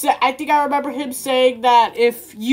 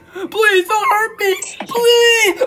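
begging; 7 semitones higher, slower than usual. Acted. Begging not to be hurt.

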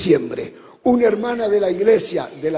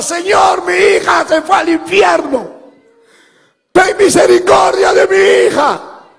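high, loud sung-out wail, slow on the last plea; mother's wailing cry; 9 semitones higher, 7 dB louder, slower than usual. Retold or imitated. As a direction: wail it to God for her daughter. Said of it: "porque ella gritaba". (madre clamando a Dios a gritos)

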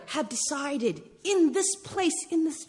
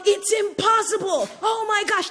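higher, louder sing-song exclaim; mock excited; 9 semitones higher, 5 dB louder than usual. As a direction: ham up the old shock. Mock past reaction, sung out.

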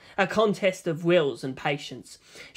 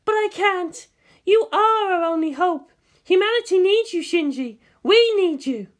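high, louder, earnest plea; pleading Misato; 13 semitones higher, 5 dB louder than usual. Acted. Misato pleading with Shinji to pilot, high and earnest. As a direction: beg him to pilot.

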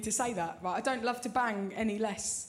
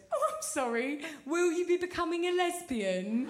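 lightly hammed-up, higher-pitched sister; mock-apologetic sarcasm; 5 semitones higher than usual. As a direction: imitate a sister's snide question, pitch climbing. Sister's sarcastic reply, acted.

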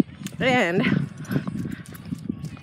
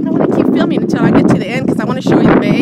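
raised voice over the wind; talking over wind; 3 semitones higher, 7 dB louder than usual. Real, not acted. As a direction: speak up loud over the wind. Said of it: "if you can't hear me, I'm sorry".

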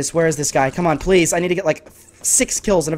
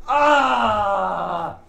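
long drawn-out groaning "ah"; loser's drawn-out cry; anguished wail; 4 semitones higher, 4 dB quieter than usual. Real, not acted. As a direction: wail in defeat, raised pitch. Loses the match.

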